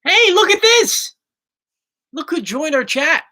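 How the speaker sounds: high, drawn-out excited call; excited greeting. Real, not acted. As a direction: call out a delighted surprise. Spots a relative joining the chat.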